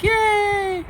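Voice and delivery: high squealing mock cheer of kids; a shrill little victory yay. Students cheering their hall win, high excited yay.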